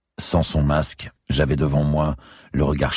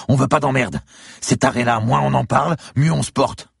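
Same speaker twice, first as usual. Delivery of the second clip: high, loud, agitated denial; desperate protest; 13 semitones higher, 4 dB louder than usual. A narrator's reading. Captive man to his interrogator, desperate protest. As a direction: protest innocence under threat.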